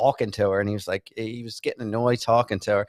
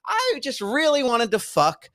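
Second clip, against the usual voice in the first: high-pitched incredulous mimicry; exaggerated high voice for a ridiculous claim; mock confession; 9 semitones higher than usual. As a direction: act out an absurd admission, pitched way up. Mocking an unlikely confession.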